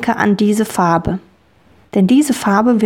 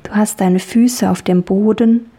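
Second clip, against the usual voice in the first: guided meditation. Acted. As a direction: ease a child into calm. Leads a children's dream journey.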